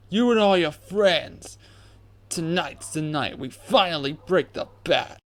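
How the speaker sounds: loud, high jeering yell; yelling thug, gleeful and angry; gloating shout. Acted. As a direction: shout the taunts at the Bat. Thug yells gloating taunts.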